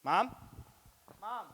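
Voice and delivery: put-on high, thin child calling for mom; small child calling for mom in a supermarket; lost little kid. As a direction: call out like a lost toddler. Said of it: "just to go".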